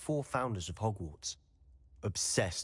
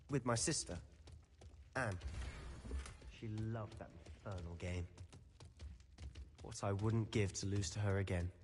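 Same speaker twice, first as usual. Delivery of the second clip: softer, wistful memory; quiet grief; 7 dB quieter than usual. Acted. Boy telling a friend about his sister Anne, softly sad. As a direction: let the loss hush the voice.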